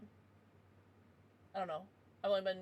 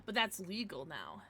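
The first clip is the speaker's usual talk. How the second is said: ordinary talk, nothing marked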